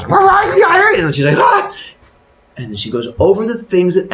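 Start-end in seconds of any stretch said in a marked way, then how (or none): 0.1-0.9 loud, high-pitched roaring growl; monster growl. Acted. Creature trapped in a box, loud growly roaring. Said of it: "growly sounds". 1.4-1.7 high, loud mock shriek; frightened scream. Woman as the box jumps, high frightened scream.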